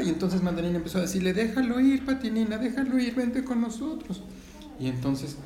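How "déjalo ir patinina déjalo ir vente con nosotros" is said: pleading. Acted. Sister pleads with clinging sister.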